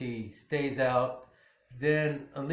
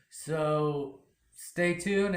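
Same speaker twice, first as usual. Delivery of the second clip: drawn-out sign-off; much slower than usual. Real, not acted. Vlog sign-off.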